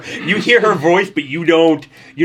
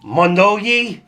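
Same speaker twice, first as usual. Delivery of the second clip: foreign language; 9 semitones higher, 7 dB louder, slower than usual. Acted. Foreign word in Noel language.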